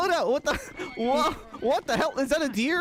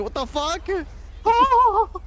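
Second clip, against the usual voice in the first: drawn-out startled shout; shocked yell; 10 semitones higher, 4 dB louder, much slower than usual. Real, not acted. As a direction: stretch it out in shock. Deer attack.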